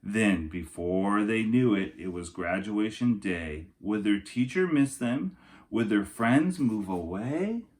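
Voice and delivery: storybook narration for kindergartners; lilting rhyming picture-book voice; reading to children. Acted. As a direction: read it like story time for little kids. Reads picture book aloud.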